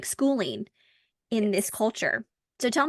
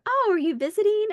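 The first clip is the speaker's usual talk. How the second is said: high-pitched mock friendly question; imitated curious stranger; 11 semitones higher, 4 dB louder than usual. Stranger asking the newcomer if visiting, imitated curiosity. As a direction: lightly act a stranger's higher-pitched inquiry.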